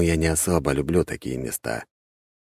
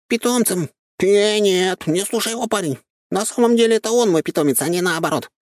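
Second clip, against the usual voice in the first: high, indignant squirrel protest; 3 dB louder than usual. A narrator's reading. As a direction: protest with offended pride. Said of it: "возмутился он".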